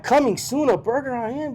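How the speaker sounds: high-pitched aghast sign reading; retold disbelief. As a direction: read the sign out high, in mock astonishment.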